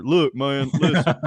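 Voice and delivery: nasal, stuffed-up Southern talk-show drawl; Doctor Phil with a busted nose, tough-guy Tennessee swagger; Trey Crowder impression. Shown as a demonstration. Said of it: "had a severe sinus attack or got punched in the nose".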